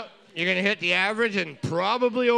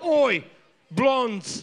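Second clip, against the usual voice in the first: put-on British accent; 5 semitones higher than usual. Answering the quiz in a mock British accent. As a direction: bark it like a London lad, in a British accent. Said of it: "You should have said it with a British accent".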